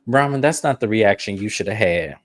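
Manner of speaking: ordinary talk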